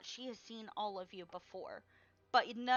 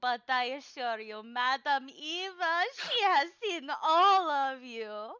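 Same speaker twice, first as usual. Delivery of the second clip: put-on old fortune teller; 3 semitones higher, 9 dB louder than usual. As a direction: announce her own name with grandeur. Madame Eva speaks in quotes.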